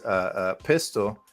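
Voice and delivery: usual speaking voice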